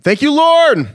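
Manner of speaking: loud, high attempt at Australian "Lauren"; attempted Australian call-out. Host trying Lauren's name in Australian, loud attempted accent. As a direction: belt out the thanks, stretching "Lauren" in would-be Australian. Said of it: "how to say Lauren in Australia".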